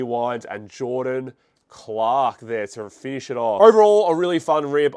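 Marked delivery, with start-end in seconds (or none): 3.6-4.9 loud, upbeat wrap-up; excited. Real, not acted. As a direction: hype it up, pleased with the pack. Wrapping up the pack.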